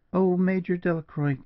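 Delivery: high, contrite woman's cry; pleading, pitch well up; exclaimed apology. A narrator's reading. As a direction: exclaim it high, appealing to him. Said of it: "exclaimed Julia".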